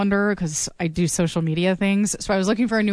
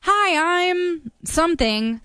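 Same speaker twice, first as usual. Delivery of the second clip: mock caller; 9 semitones higher, much slower than usual. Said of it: "She said".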